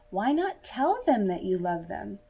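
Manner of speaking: ordinary delivery, nothing marked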